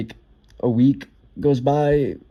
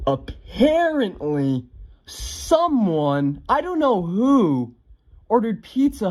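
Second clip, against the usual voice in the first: drawn-out mock-innocent sing-song, pitched up at the end; feigned innocence; 9 semitones higher, slower than usual. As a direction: play coy, stretching it out as if blameless. Sly feigned innocence about the prank.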